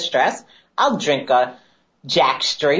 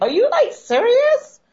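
shrill, much higher mock disbelief; incredulous. Retelling her reaction to her sober boyfriend, shrill disbelief. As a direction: squeal it like you can't believe him.

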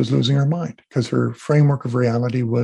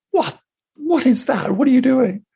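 retold panicked cry, much higher and rising; alarmed; 13 semitones higher, 3 dB louder than usual. Nurse who sees energy from his hands, shocked high cry. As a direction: imitate a startled nurse gasping out questions. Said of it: "she freaked out".

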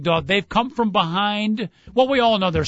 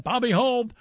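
ordinary talk

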